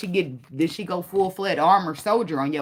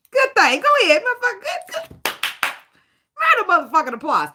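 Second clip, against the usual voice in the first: sarcastic cheer; 11 semitones higher, 4 dB louder than usual. Pretend.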